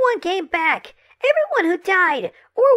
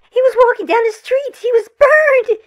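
louder and louder in horror; distraught, building panic; 2 semitones higher, 5 dB louder than usual. Acted. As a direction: break down into frantic horror. Mother sees dead son.

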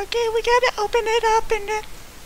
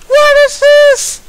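loud high slow outcry; stunned yell at the screen; shocked; 5 semitones higher, 9 dB louder, slower than usual. Real, not acted. Shocked again by outfits.

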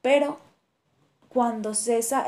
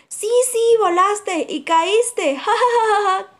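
high, loud cackling jeer; birds screeching laughter; 9 semitones higher, 7 dB louder than usual. A narrator's reading. Birds laugh at the kangaroo, high and loud. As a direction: shriek with laughter. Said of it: "chillan y gritan los pájaros".

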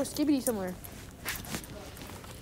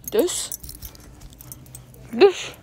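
usual speaking voice

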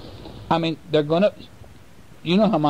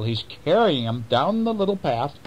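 plain reading voice

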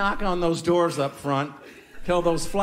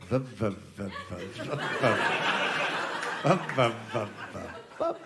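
low droning vup vup sound; mock propeller drone, deep and quiet; imitated engine noise; 7 semitones lower, 6 dB quieter than usual. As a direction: mimic a struggling plane engine, low and soft. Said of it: "It's like, it's like".

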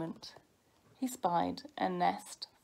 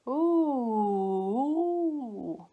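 high swooping "ooh" howl; little wolf howl; 3 semitones higher, 5 dB louder than usual. A narrator's reading. Baby wolf scolded by his brother, high howl. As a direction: howl like a cub told off. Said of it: "howled Smelly Breath".

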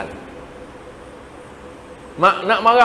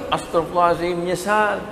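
ordinary delivery

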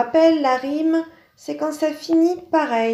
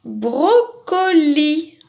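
"Brocoli" said very slowly, every syllable drawn out; slow teacher's spelling out of "Brocoli"; slow syllable reading; 4 semitones higher, 4 dB louder, much slower than usual. Shown as a demonstration. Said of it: "ça finit comme tapis".

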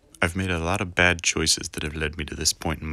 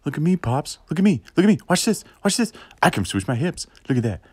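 put-on much higher, loud kid voice; excited child voice; 10 semitones higher, 6 dB louder, faster than usual. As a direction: show off like a kid to dad. Kid showing off to father.

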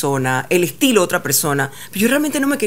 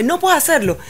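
mock coach order; 9 semitones higher than usual. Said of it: "me dice".

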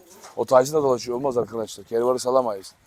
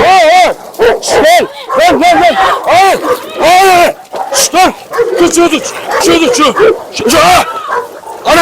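real high frantic shouts, much louder; yelling to break up a dog fight; panicked shouting; 13 semitones higher, 7 dB louder than usual. Real, not acted. Köpek kavgasını ayırmaya çalışıyor. (köpek kavgasını ayırırken panikle bağırıyor)

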